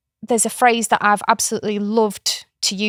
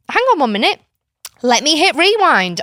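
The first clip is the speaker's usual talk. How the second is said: high, louder playful call to pause; playful announcement; 7 semitones higher, 5 dB louder than usual. Acted. Aunt stops a tantrum with a game, high playful call. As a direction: cheerfully halt the scene. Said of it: "I will say".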